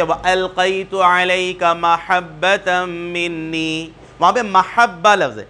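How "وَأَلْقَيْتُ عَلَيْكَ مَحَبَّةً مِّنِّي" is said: slow Arabic recitation of مَحَبَّةً with fatha on meem; Quranic recitation. Shown as a demonstration. Teacher demonstrating Quranic pronunciation, slow recitation. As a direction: recite وَأَلْقَيْتُ عَلَيْكَ مَحَبَّةً مِّنِّي slowly in tajweed. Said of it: "جیسے".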